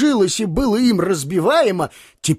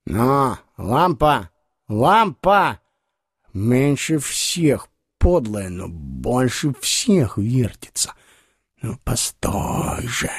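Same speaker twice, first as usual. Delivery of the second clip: put-on slow, low drunken muttering; drunken comic; 6 semitones lower, slower than usual. Drunk comic grumbling at the wobbling lamp, slow tipsy voice.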